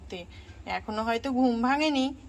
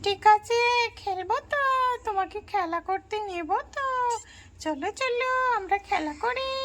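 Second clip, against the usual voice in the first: put-on high eager little girl arriving to play; child voice; 9 semitones higher than usual. Babli calling for Piya, high child voice. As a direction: lift the voice up like an excited young cousin.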